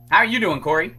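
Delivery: usual speaking voice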